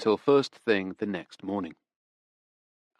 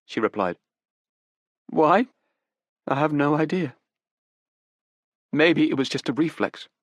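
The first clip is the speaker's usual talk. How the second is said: put-on higher, urgent young woman pleading; desperate young woman; 7 semitones higher, 4 dB louder than usual. Daughter defends her father to detectives, desperate. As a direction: grasp for excuses, pitched up.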